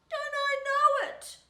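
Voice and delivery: very high, sighing little caboose voice. Acted. Caboose sighing back to the flat car, very high. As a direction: sigh it out in a tiny voice. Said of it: "sighed the little red caboose".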